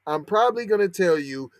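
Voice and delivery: ordinary talk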